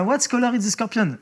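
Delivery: operator. Retold or imitated. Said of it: "Elle m'a dit".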